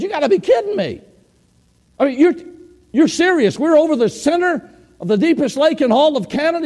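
high-pitched, disbelieving protest; incredulous. Retold or imitated. Evangelist learns he is on lake.

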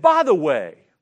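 furious preacher's retelling; retold angry outburst. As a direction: bellow it like the angry magician. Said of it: "he got angry".

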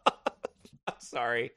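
slow, low words after a laughing fit; laughed-out apology; helpless laughing. Real, not acted. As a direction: apologize through real laughter. Laughing too hard to continue.

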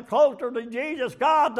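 ordinary delivery

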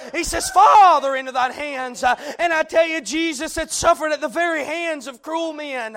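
loud, high preaching climax; full-force preaching. Acted. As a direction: drive it home from the pulpit.

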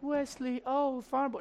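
high, dreamy mock swoon; swooning girl. Princess Bride fan pining for the farm boy, mock swoon. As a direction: sigh it out high like a smitten fan. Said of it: "every girl that's ever watched Princess Bride".

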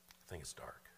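near-whisper, low and very soft; hushed aside. Real, not acted. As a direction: drop to a hushed, ominous murmur. Hushed answer to own question.

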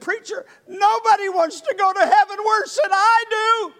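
crying out. Retold or imitated. Joe Reed, weeping to the preacher, cries out with a high loud voice. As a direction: voice high and loud, breaking with tears. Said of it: "he just started boo-hooing".

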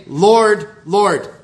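each LORD hit firmly and set apart; emphatic address. Acted. Preacher acting believers who call him Lord, emphatic. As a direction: call out "Lord, Lord" with weight on both. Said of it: "some of us emphatically call him Lord".

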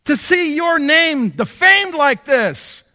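high, loud cry to God, slow in places; desperate shouted prayer. Acted. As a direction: plead at the top of the voice. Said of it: "it's like Daniel saying".

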